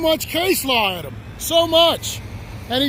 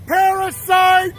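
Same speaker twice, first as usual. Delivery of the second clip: angry slow shout; 2 semitones higher, slower than usual. Real, not acted. Man showing police what vulgar sounds like, shouting angrily. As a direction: bellow each phrase slowly, with pauses between.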